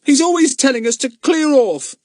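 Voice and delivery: high, loud defiant answer; boy answering back. A narrator's reading. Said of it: "said Pip defiantly".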